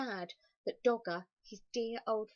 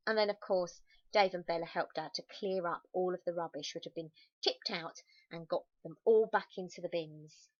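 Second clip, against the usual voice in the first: ordinary delivery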